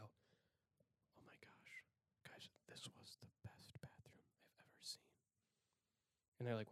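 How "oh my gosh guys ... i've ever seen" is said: intense hushed stage whisper; awestruck whisper. Retold or imitated. Coming back to tell friends, awestruck whisper. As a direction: whisper it slowly, as if overwhelmed.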